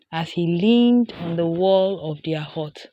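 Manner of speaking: plain reading voice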